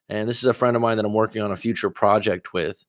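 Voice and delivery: ordinary talk, nothing marked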